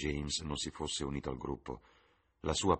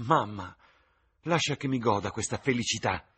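voce di ragazza, più acuta, piena di slancio; giovane innamorata; 6 semitones higher, 6 dB louder than usual. Acted. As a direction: fanciulla entusiasta che si confida. Sibyl supplica la madre. (Sibyl alla madre, voce acuta e sognante)

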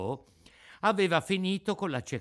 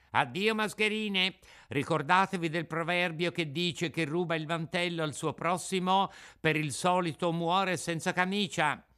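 put-on raised, lively puppet-boy voice; scornful Pinocchio; 6 semitones higher than usual. Puppet refuses the begging swindlers, smug and scornful. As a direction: lecture them, then dismiss them.

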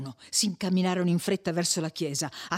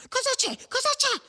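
very high, frantic repeated question; panting alarm. A narrator's reading. Perpetua arrives running and questions the fleeing brothers, panting alarm. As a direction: ask urgently, voice pitched right up. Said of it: "domandò Perpetua ansante".

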